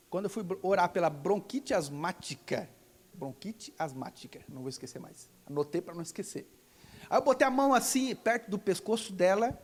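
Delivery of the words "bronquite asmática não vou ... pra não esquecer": low, much softer note to self; muttered aside. Real, not acted. Preacher reminding himself of the illness's name, muttered aside. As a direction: say it under your breath to yourself.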